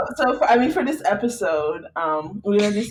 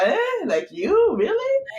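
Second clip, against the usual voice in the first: skeptical; 10 semitones higher, slower than usual. Retold or imitated. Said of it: "I was like".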